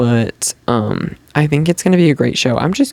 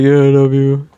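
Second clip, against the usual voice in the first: lightly voiced goofy Scooby-Doo impression of [name]; a hint of a dopey cartoon dog drawling the line; 3 dB louder than usual. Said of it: "why did I just say it like Scooby-Doo".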